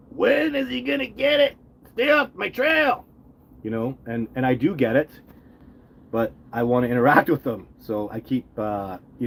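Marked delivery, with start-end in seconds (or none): 0.1-3.0 very high, slow put-on Sasquatch squeal. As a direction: squeak it out like a grumpy forest giant guarding his trail. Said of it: "And the Sasquatch are there going".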